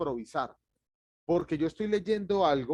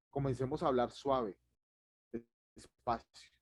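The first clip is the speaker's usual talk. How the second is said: soft and slow; 3 semitones lower, 4 dB quieter, slower than usual. Shown as a demonstration. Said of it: "Una clave para que el pánico escénico se vaya suavemente".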